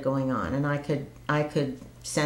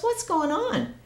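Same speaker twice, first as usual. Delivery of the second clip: husband's puzzled question. Retold or imitated. Husband puzzled by her tears.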